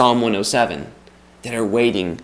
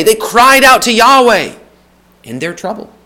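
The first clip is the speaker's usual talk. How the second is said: cried out to Yahweh, raised high and loud; rising preacher's cry on the repeated line; preaching refrain; 9 semitones higher, 5 dB louder than usual. Acted. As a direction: build the refrain, leaning on out. Refrain building louder.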